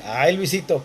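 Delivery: usual speaking voice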